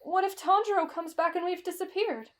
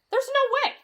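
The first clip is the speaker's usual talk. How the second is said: high-pitched incredulous outburst; shocked disbelief; 9 semitones higher, 4 dB louder than usual. Acted. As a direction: blurt it out in disbelief. Listener disbelieves the ghost story.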